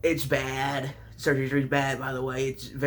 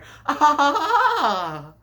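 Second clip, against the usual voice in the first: loud laugh; 12 semitones higher, 8 dB louder than usual. Real, not acted. Laughs at own joke.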